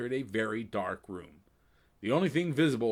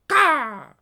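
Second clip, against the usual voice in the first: high, loud raven squawk; sharp bird call; raven caw; 12 dB louder than usual. Acted. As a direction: caw like a crow. Raven Anna answers Jess.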